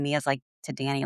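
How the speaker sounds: ordinary delivery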